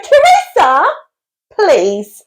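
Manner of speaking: high, stretched-out exasperated appeal; mock attitude. Customer protesting to the curry sauce server, mock attitude. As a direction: plead loudly with mock exasperation. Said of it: "No need for the attitude".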